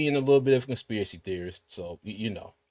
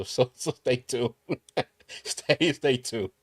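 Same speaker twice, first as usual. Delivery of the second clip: laughing; slower than usual. Real, not acted. Teasing a funny bit for Doug.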